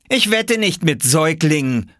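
put-on high girlish put-down; child snubbing. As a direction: snub him in a high child voice. Girl snubs boy.

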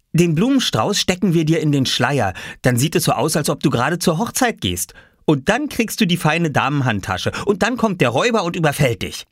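put-on eager child character. Boy lays out robber game to little brother, eager child voice. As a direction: play the bossy child, voice lifted.

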